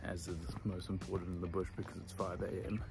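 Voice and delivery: hushed early-morning whisper. Real, not acted. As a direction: whisper so as not to wake anyone.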